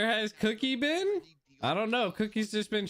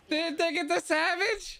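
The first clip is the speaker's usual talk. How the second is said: normal talking voice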